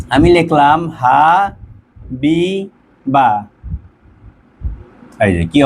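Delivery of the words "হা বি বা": "হা বি বা" slowly, each syllable alone; long held vowels in "হা বি বা"; split into syllables. Shown as a demonstration. Teacher writing a name out for students, "হা বি বা" syllable by syllable.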